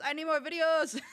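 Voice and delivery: mock urgent demand; meme quote voice. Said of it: "instead of bullets, it's".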